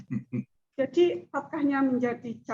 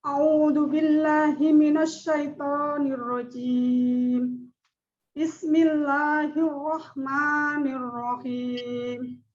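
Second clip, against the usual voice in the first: slow, measured chanting of the verse; Quran recitation; slower than usual. Acted. Opening her talk to the class with slow Quran recitation. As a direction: intone each phrase slowly and reverently.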